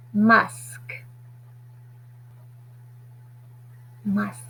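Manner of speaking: Musk whispered each time, no voice. Shown as a demonstration. Teacher whispering the new word to the class, whispered. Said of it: "To make musk".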